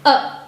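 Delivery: A said as short rounded "euh"; French name of E, written A here, said "uh"; French letter name. Shown as a demonstration. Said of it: "but in French it is A".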